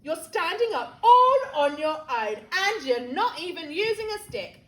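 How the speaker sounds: loud, lively amazement; excited cry. A narrator's reading. George amazed that Grandma is standing, excited cry. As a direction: marvel aloud at full voice. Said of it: "George cried".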